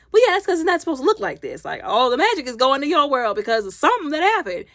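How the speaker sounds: high, quick, insistent explanation; animated explaining. Retold or imitated. Retold characters explaining urgently.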